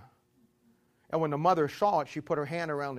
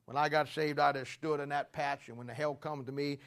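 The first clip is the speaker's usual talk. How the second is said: ordinary talk, nothing marked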